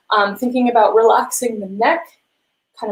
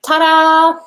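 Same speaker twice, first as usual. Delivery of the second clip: cheerful ta-da; 6 semitones higher, 7 dB louder than usual. Real, not acted. Announcing arrival at piano.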